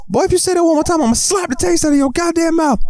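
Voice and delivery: very high mock-furious rant; hammed-up shrieking old man; threatening grandpa. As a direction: threaten the kid as a cartoon grandpa. Voices grandpa threatening kid.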